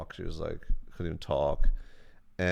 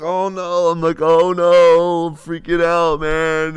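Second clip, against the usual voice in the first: much higher and louder panic; freaking out while recognized; panicked; 9 semitones higher, 13 dB louder, slower than usual. Retold or imitated. To himself as fans approach, loud high panic.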